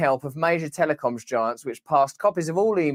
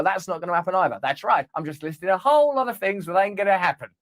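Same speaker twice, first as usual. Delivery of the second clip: ordinary delivery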